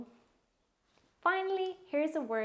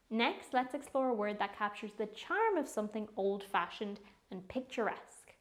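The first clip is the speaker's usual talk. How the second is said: ordinary talk, nothing marked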